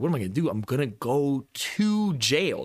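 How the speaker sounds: mock panicked rant, slow and high. Retelling friends his jail panic, high mock wail. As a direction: replay his own freak-out, drawn out. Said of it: "I was like".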